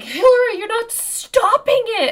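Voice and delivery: very high, loud imitation of a scolding onlooker; hammed-up, high-pitched outraged protest; Frank. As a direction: shriek it as an exasperated accusation. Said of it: "and then Frank's like".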